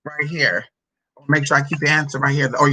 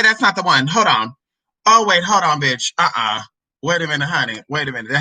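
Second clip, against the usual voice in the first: ordinary delivery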